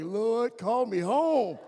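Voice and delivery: slow, drawn-out prayer to the Lord; mock desperate prayer after a rough walk; weary plea to God. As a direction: pray to be taken home. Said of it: "you were like".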